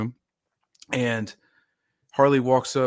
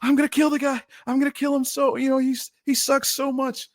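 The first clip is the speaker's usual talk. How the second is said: high-pitched, worked-up threat, retold; mock fury walking off the field; fired-up rant; 12 semitones higher than usual. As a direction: pitch it way up, like you're still fuming on the field. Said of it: "And I was like".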